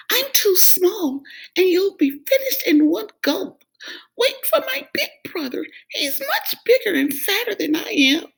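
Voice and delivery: high, breathy frightened begging; little goat begging the troll; trembling plea. Acted. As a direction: beg for your life. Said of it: "trembled where he stood".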